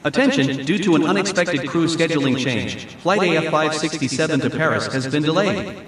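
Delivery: airport announcement. Shown as a demonstration.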